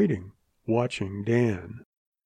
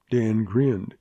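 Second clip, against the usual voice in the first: ordinary delivery, nothing marked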